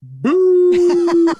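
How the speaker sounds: long, high, loud boo; mock jeering, held out high; imitated crowd boo. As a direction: boo like a whole hostile crowd. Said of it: "I remember them juggalos".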